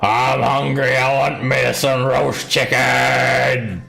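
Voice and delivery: put-on slow, drawn-out drunk craving; drunk man. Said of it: "really, really drunk".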